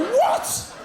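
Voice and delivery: high, incredulous laughing squeal; the world hearing about hurling, scoffing; mock disbelief. Everyone reacting in disbelief.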